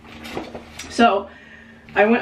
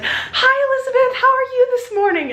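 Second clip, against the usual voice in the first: much higher, chirpy sunny greeting; bright bubbly friend saying good morning; sweet friend; 4 dB louder than usual. Retold or imitated. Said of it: "she's, like, the sweetest person I know".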